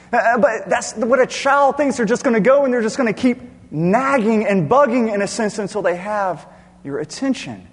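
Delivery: loud, high, impassioned preacher; full-force preaching. Real, not acted. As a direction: drive every phrase home at full voice, stretching key words.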